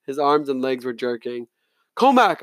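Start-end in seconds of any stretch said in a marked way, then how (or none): 2.0-2.4 high urgent cry; sudden alarmed cry for help; cried out. A narrator's reading. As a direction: call out in panic. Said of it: "I cried".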